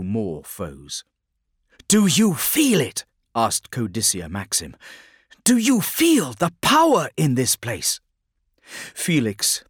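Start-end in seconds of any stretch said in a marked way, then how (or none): 1.9-3.0 intense psyker. A narrator's reading. Psyker voiced in narration. 5.4-8.0 high, loud, otherworldly psyker; intense psyker. A narrator's reading. Psyker voiced in narration.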